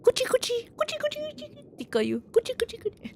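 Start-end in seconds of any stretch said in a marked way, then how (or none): 0.0-1.8 high, playful tickle chant; tickling sound. Shown as a demonstration. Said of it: "that's a tickling sound effect". 2.3-3.1 high, playful tickle chant; tickling sound. Shown as a demonstration. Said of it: "that's a tickling sound effect".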